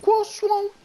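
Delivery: very high squeak; squeaky high voice. Pretend. Mock high voice silliness.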